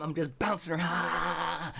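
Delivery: high, slow, straining shout; mad shout. Retold or imitated. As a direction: yell it while hopping with rage. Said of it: "I'm so mad".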